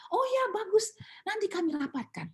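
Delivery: mock-enthusiastic, high-pitched approval; high singsong promise, easing down; breezy brush-off. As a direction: imitate an official politely stalling. Imitates principal's breezy brush-off.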